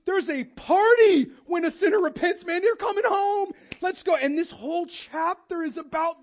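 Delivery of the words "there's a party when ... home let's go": high, loud, festive invitation; joyful welcome. Pretend. God welcoming a sinner home, joyful and loud. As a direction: throw the doors open and cheer. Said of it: "God goes".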